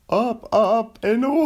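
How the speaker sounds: high and loud heroic catchphrase; Seinfeld as Buzz Lightyear. Acted. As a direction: announce it like a cartoon space hero. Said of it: "my Seinfeld doing a Buzz Lightyear".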